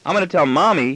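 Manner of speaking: put-on high-pitched tattletale boy; childish threat. Son threatening his father with Mommy, high childish threat. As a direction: threaten lightly to tell on a parent. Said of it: "he threatens".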